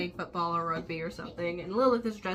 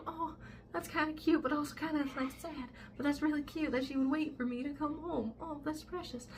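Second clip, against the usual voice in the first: cooing, touched; 6 semitones higher, 5 dB quieter than usual. Retold or imitated. Ben touched Eleanor waited for him.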